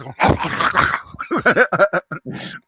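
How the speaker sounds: comic gobbling noises; imitated frantic eating. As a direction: mimic wolfing down breakfast. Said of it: "me eating breakfast was like, uh, like this".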